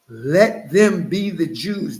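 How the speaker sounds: normal talking voice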